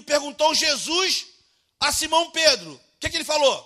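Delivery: rising to a loud, high shout at the congregation; fiery preacher shouting the text at the church; preaching at full force. Real, not acted. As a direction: build from urging into full-throated shouting.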